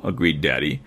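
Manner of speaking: usual speaking voice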